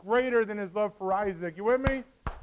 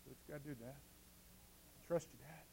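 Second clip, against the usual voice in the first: put-on hushed trusting boy; 5 semitones lower, 21 dB quieter than usual. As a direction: breathe it out like a son on the altar. Isaac on the altar.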